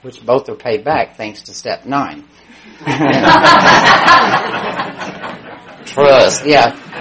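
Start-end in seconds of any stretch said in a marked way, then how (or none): 2.8-5.5 a real, high, bursting laugh; hearty laugh. Real, not acted. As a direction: laugh out loud at your own story. Laughs after his own joke.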